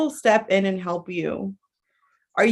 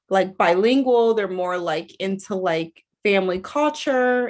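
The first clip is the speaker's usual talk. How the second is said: normal talking voice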